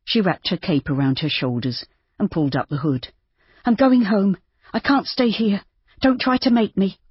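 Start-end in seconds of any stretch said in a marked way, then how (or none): 3.6-7.0 Judy, defiant fear. A narrator's reading. Judy refusing to let Rob stop her, high and defiant. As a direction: refuse him, voice tight with fear.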